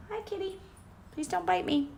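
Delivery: high, hushed pet voice; talking to the cat. Real, not acted. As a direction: coo nervously at the cat. Cat about to bite.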